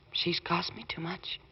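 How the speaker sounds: slow hushed whisper; wife's whispered reproach to her husband. Acted. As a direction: breathe it out, hurt and slow. Wife's hurt remark to husband.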